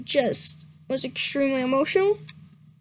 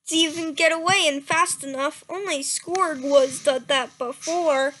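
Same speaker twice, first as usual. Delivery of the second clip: normal talking voice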